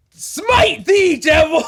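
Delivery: high, loud battle cry; hammed-up smiting shout at the devil; mock holy shout. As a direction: bellow it like a crusading knight. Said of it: "I say".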